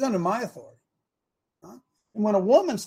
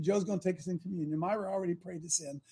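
usual speaking voice

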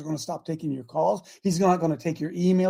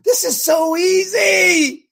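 high, slow, ringing cry; fervent shout; 11 semitones higher, 9 dB louder, much slower than usual. Real, not acted. Preacher urging listeners to organize, shouting slowly. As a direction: belt it out to stir them.

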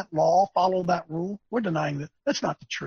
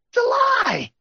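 impassioned outcry; 4 dB louder than usual. Real, not acted. Preacher denouncing a lie to his listeners, impassioned outcry. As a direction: cry it out high, stretching the last word.

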